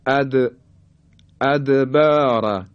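"ادبار" with د bounced audibly; qalqalah on dal. Shown as a demonstration. Teacher demonstrating the letter dal, bouncing د in "ادبار". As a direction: recite "ادبار" slowly, releasing د with a short echo. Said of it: "حرف دال".

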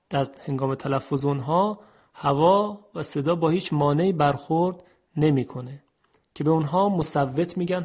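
usual speaking voice

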